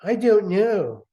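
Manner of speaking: hammed-up reading of the mother's line; the mom speaking. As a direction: drag it out like a flustered mother. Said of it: "let's hear from Mom's own mouth".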